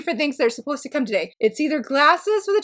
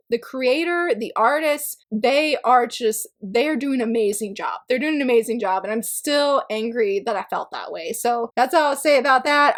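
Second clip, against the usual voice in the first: ordinary talk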